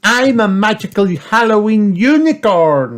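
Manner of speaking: put-on high, loud show-off child; Peppa Pig. A narrator's reading. Peppa showing her costume, high and proud. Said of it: "said Peppa".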